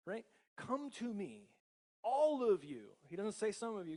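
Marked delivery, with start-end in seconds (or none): 0.6-2.9 high and loud, leaning on all; a ringing come to me, all of you; emphatic preaching. Acted. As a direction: proclaim it to everyone. Preacher quoting Jesus with force.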